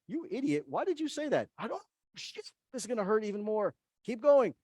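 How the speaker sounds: high, scolding inner voice berating yourself; inner critic voice. Retold or imitated. Brain scolding you mid-argument, high and scolding. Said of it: "your brain goes through a process of metacognition where it's looking up here and saying".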